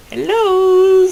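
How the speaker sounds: very high, sly little hello; creepy creeper greeting. Retold or imitated. Mimicking the creeper lurking in the corner, very high sly hello. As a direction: greet them like a lurking stalker. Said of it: "He's like".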